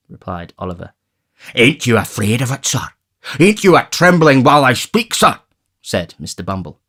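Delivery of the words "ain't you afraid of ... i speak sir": pompous beadle, a bit higher; beadle menacing. A narrator's reading. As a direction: try to scare him with importance.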